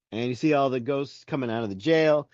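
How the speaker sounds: normal talking voice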